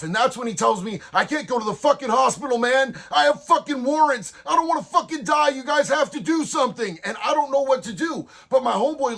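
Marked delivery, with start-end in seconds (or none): 1.1-7.0 panicked pleading. Retold or imitated. Wounded man begs the narrator for help, panicked. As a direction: beg like you're bleeding out and scared.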